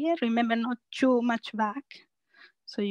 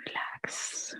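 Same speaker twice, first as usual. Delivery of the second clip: whispered release cue; 14 dB quieter than usual. Real, not acted. Coach guiding the class to let go, whispered. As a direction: breathe the word out in a whisper.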